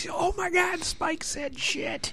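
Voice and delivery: high, slow scandalized gasp; shocked kid. Retold or imitated. Kids shocked in theater.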